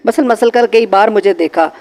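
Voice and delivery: plain reading voice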